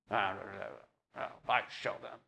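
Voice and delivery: a muttered grumble under the breath; mock muttering. Someone walking away unhappy, muttering under the breath. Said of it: "walk away muttering under your breath".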